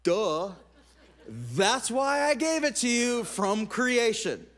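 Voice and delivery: playful obvious duh from above; lightly voiced God saying duh; mock God voice. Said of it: "God's up there going".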